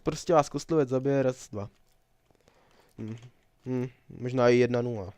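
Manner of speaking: ordinary delivery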